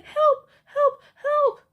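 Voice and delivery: very high, loud yelps; short squealed cries for help, far above normal pitch; yelling for help. A narrator's reading. Vermin teachers up on desks, yelling for help in high squeals. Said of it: "holding up their skirts and yelling".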